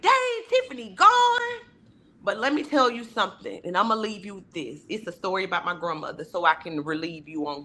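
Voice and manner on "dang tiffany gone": much higher mock lament; mourning friend. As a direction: sigh it out high as a grieving friend. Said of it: "you be like".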